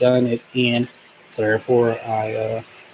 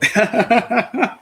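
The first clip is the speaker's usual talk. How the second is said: short high giggle; high laugh; 12 semitones higher, 3 dB louder than usual. Real, not acted. Laugh after the names.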